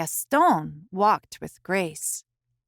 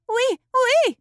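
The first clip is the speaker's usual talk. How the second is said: high, louder delighted oui oui; happy; 5 dB louder than usual. A narrator's reading. Missus Poodle gladly agreeing with Missus Bulldog, happy. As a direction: burst out with joy. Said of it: "agreed happily".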